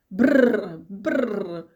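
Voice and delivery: loud rolled shivering "brr"; chattering-cold brr. A narrator's reading. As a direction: trill the lips as if freezing. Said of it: "Flopův zobák začal cvakat".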